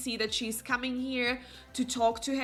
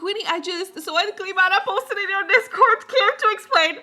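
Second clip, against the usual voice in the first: much higher, louder, bubbly; excited greeting; 10 semitones higher, 10 dB louder, faster than usual. Real, not acted. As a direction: greet chat with big excitement. Greeting viewers, excited teasing.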